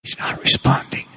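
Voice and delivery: hushed. Retold or imitated. Priest hushes the class.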